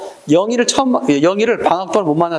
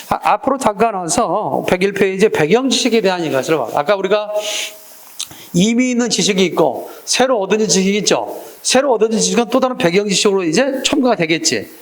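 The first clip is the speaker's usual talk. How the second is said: normal talking voice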